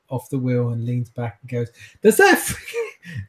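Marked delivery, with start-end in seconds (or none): 2.0-3.3 high, drawn-out exclamation; exclaiming in character. Acted. Driver leaning back to talk to his passengers, high exclaiming. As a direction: throw it out high in mock exasperation.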